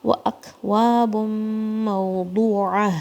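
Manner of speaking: slow, measured tajweed recitation; Quran recitation. Shown as a demonstration. Teacher recites the verse slowly for students to repeat.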